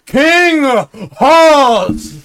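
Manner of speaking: high loud herald call; royal announcement. Acted. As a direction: announce the king grandly. Announcing the king.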